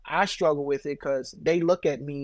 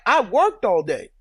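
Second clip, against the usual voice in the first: louder, higher mock indignation; mild indignant rebuke; 5 semitones higher, 6 dB louder than usual. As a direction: justify yourself to a borrowing relative, mildly indignant. Acted rebuke to relatives asking money.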